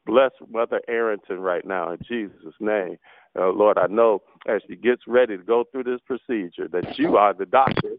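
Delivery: ordinary delivery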